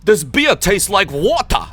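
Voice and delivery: high, loud mock German tourist; imitating excited German friends, German accent. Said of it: "my two German friends".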